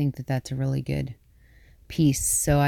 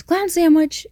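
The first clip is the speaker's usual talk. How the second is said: high loud gleeful clown shout; 14 semitones higher, 7 dB louder than usual. Retold or imitated. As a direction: yell it like a jump-scare clown. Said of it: "and he goes".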